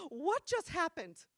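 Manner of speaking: high, slow disbelief; mock astonishment. Girl baffled by mom's outburst, stunned disbelief.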